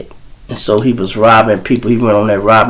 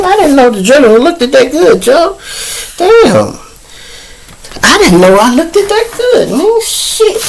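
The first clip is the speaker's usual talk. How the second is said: amazed self-admiration; 13 semitones higher than usual. Real, not acted. Admiring himself on shirt.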